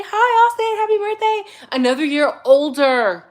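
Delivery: high, loud birthday squeal; excited greeting. Real, not acted. Greeting Austin on his birthday, high and excited.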